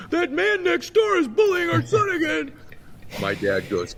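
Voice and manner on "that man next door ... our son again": high, loud mock-mother shout; upset mom. Mom reporting the bully to dad, high frantic shout. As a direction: imitate a frantic mom yelling in a high pitch.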